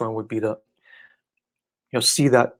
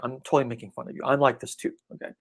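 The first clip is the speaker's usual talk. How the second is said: usual speaking voice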